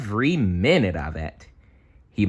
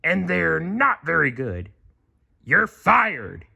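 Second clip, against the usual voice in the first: high put-on crab boss voice; Mister Krabs voice; 9 semitones higher than usual. A narrator's reading. Mister Krabs breaking bad news, put-on voice. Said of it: "Mister Krabs replied".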